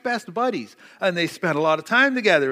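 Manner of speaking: ordinary talk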